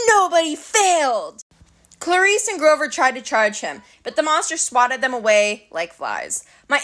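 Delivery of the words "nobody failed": gleeful. Acted. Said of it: "he said gleefully".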